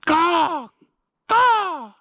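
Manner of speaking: high, loud crow caws; crow call. Shown as a demonstration. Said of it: "It's my best crow call".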